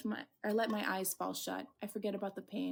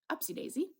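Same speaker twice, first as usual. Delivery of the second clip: sing-song, high and playful; a cheeky little lift; 9 semitones higher than usual. Acted. Playful exclamation while standing.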